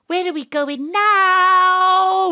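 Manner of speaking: high, loud, slow drawn-out cry; frightened wail; alarmed cry. Acted. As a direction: yell while flying off. Whisked away by spirit.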